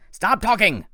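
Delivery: loud, high, cold command; menacing villain. Acted. Doctor Thorne ordering the kids to stop talking, loud and cold. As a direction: snap the order at the kids like a monster. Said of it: "Doctor Thorne said".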